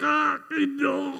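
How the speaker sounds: a mild, half-acted tearful stammer; workman breaking down. Pastor imitating the workman falling apart after the boy's question, mild mock crying. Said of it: "he just starts disintegrating".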